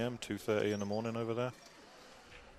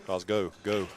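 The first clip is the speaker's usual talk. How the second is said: mock cheer; 6 dB louder, slower than usual. Cheering for the Glasgow player.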